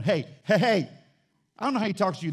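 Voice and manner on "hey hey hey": God calling out. Pretend. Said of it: "God's going".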